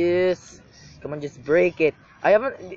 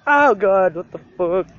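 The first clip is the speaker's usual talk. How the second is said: ordinary delivery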